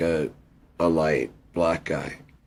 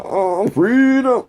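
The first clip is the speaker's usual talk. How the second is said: very high, slow, louder orator's cry; Martin Luther King speech voice; 9 dB louder, much slower than usual. Pretend. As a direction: stretch it out like a soaring sermon. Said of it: "That's how we talked. And gave speeches".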